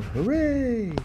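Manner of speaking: high hooray cheer, far up; a thin high crowd cheer; mock cheer. Crowd greeting Jesus, high mock cheering. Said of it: "they're shouting".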